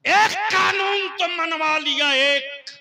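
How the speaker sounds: booming shout to the crowd; booming political rally speech. Real, not acted. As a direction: shout it out, high and loud.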